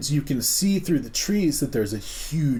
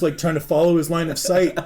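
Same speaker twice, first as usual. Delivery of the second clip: ordinary delivery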